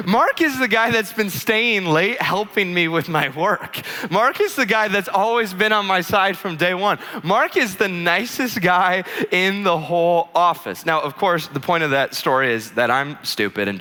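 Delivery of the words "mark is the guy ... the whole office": high, slow, insistent defence; imitated indignation. Wife defending kind coworker to husband, imitated indignation. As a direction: hammer home each point in his defence.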